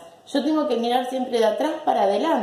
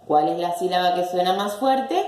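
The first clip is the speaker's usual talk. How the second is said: normal talking voice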